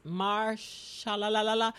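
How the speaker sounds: slow "Marsha" trailing into "la la la la"; fumbled name. Real, not acted. Host asks guys for help with a name, fumbling "Marsha la la la la". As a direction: give up on "Marsha" with a string of la-las. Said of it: "How do I say his name".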